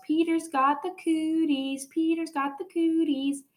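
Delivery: put-on high, slow teasing chant of a bossy girl; taunting sing-song. A narrator's reading. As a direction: sing it out to tease him, high and drawn out. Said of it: "she yells".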